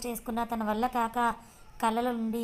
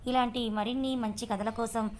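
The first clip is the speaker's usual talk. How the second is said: louder, punchy teaser read; channel outro; 5 dB louder than usual. Acted. Narrator urging viewers to follow the channel, energetic outro. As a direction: hype up the next episode for listeners.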